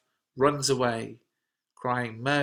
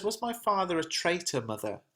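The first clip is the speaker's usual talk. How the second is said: higher-pitched, lightly voiced young son; questioning child; 6 semitones higher, 3 dB quieter than usual. A narrator's reading. Son questions his mother about his father, lightly voiced child. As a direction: ask it high, like a child.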